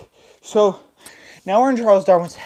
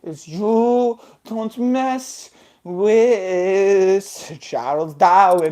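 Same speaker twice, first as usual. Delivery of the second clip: slow, stretched-out menacing chant; theatrical Darwin threat; 6 semitones higher, much slower than usual. Acted. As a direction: draw each phrase out grandly. Villain acted as drawn-out sung threat.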